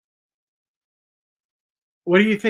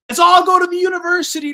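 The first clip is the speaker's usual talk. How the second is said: high, loud mock crowd call; mocking shout; 13 semitones higher, 8 dB louder than usual. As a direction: shout it like a naive follower. Mocking people chasing university.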